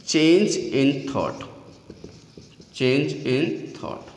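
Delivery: very slow, word by word; slow dictation. Real, not acted. Teacher giving students a definition to write, slow dictation. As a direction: spell out the phrase slowly with gaps between words.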